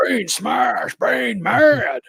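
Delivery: hammed-up smash-monster roar; dumb brute Bane. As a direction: bellow like a mindless wrestling brute. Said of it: "And just goes".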